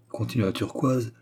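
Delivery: plain reading voice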